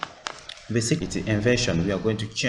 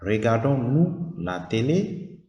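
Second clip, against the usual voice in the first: "Regardons-nous la télé?" slowly, rising on "télé"; slow question rise; 2 semitones higher, slower than usual. Shown as a demonstration. Teacher models the inverted question, rising on "télé". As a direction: draw out "télé" upward. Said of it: "the verb came first".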